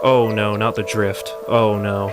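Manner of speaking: ordinary talk, nothing marked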